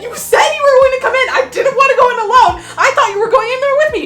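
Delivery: incredulous yell. Retold or imitated. Retelling to viewers how she shouted at mom in disbelief. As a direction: hammer mom with panicked questions, half laughing.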